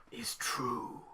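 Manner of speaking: voiceless storyteller hush; spooky whisper. Acted. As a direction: whisper the twist ominously. Urban legend intro, spooky.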